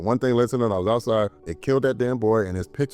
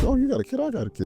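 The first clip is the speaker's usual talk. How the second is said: mock friendly parent voice. Killers tricking other families.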